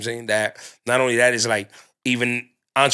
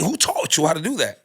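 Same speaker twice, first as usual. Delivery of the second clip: higher-pitched mock disbelief; incredulous self-questioning; 6 semitones higher than usual. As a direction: question yourself like you can't believe it. Scolds self in acted question.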